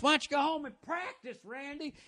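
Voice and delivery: high, strained movie-villain impression.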